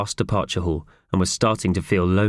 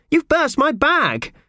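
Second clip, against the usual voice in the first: very high, slow indignant wail; outraged accusation; 3 dB louder, slower than usual. Acted. Woman outraged at her burst bag.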